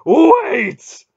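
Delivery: huge high shouted command; explosive bellow from a god; sudden roar. A narrator's reading. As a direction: roar it out all at once. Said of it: "Mara roared suddenly".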